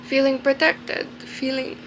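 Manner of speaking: choked-up rise as the tears come; crying. Real, not acted. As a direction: push the words out while crying. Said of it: "Shit, I look so stupid".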